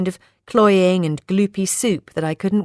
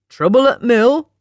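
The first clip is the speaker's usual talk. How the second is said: put-on jokey Yorkshire accent, pitched up; awful Yorkshire accent; 6 semitones higher, 6 dB louder than usual. A narrator's reading. Friend trying to raise a smile, hammy Yorkshire accent. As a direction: put on bad Yorkshire, rising into a question. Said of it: "with an awful Yorkshire accent".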